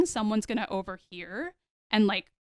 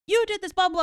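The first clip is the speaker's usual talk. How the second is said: blowing up; 9 semitones higher than usual. Retold or imitated. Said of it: "just blowing up at her".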